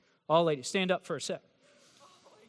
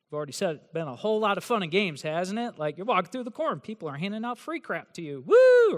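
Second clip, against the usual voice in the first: ordinary talk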